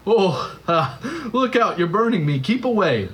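high, alarmed ghost crying out; panicked ghost. A narrator's reading. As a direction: yelp as if scorched by heat. Ghost burned by the angel.

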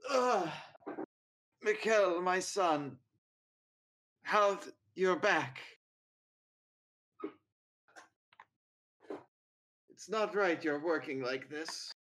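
a hint of a bedridden old man; old father voice. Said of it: "he just goes".

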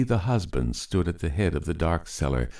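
ordinary delivery

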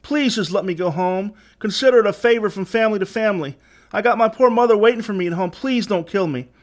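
high-pitched plea for life; frightened begging, held high. A narrator's reading. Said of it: "begged Asa".

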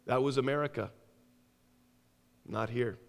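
soft, low, scornful taunt fading down; a quiet sneer at his freedoms; cold taunt. Acted. Secret police mocks the American prisoner, quiet cold sneer.

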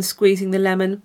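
ordinary delivery

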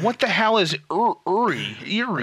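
high, puzzled stumbling read; mock confused reading. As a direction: stumble over the word, baffled. Mocking friend struggling to read.

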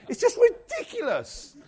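loud, very high exclamation; mock incredulous. Preacher scoffing at leaving money on the counter, mock incredulous. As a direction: squeal it in comic disbelief. Mock outburst at absurd plan.

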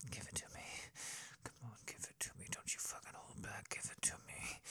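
pleading, fast whispered demands; hushed, insistent bedroom whisper; urgent whisper. Acted. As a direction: whisper it, urging them on. Intimate whispered roleplay.

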